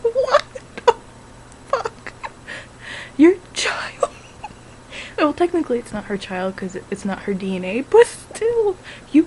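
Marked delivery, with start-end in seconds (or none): 0.0-4.1 high, loud, laughing through the shock; half-laughing, scandalised at the screen; laughing disbelief. Retold or imitated. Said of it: "I'm sitting here like".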